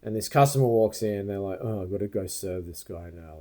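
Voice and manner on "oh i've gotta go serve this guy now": low, soft mock grumble; put-upon attitude. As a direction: grumble it like serving him is a chore. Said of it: "that was the attitude".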